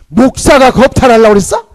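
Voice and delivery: loud, high incredulous question; outraged disbelief. Retold or imitated. Elder reacting in shock to the accusation, loud. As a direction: blurt it out in shock.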